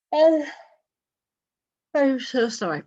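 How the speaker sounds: a word lost in a big yawn. Real, not acted. Teacher yawning mid-sentence to the class while answering. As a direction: talk through a real yawn. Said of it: "I am so sorry for all the yawning".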